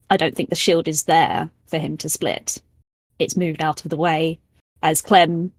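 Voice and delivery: usual speaking voice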